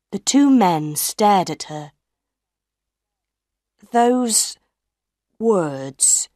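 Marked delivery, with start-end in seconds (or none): 3.9-6.3 halting, word by word; slow. A narrator's reading. Firmin answers Madame Giry's warning, slowly. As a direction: spread the words apart with a long pause between. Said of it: "Monsieur Firmin said slowly".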